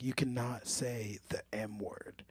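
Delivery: slow hushed whisper; stern boss whisper. Retold or imitated. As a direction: scold him in a slow whisper. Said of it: "She was like".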